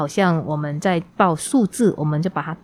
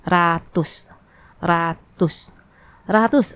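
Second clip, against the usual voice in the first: ratus repeated slowly; slow teaching repetition; much slower than usual. Shown as a demonstration. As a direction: say ratus three times, slowly. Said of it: "我们还要学习两个常用的单字".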